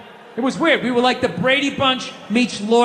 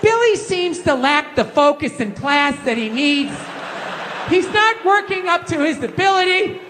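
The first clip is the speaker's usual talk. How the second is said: lightly voiced schoolteacher; teacher's report; 4 semitones higher than usual. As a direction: read it like a report card. Teacher's report about Billy.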